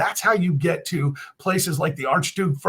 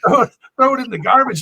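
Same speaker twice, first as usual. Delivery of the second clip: raised, fast, scornful push to toss it out; louder and higher, throw the idea away fast; emphatic loud dismissal; 7 semitones higher, 7 dB louder, faster than usual. Real, not acted. Veteran dismissing the pundit's claims, scornful loud outburst.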